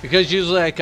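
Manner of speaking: ordinary talk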